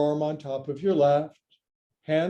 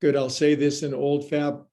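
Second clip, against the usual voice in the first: ordinary talk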